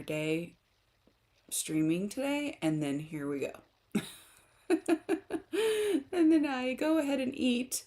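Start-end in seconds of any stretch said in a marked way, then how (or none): 4.8-7.8 laughing. Real, not acted. Laughing at her own mistake.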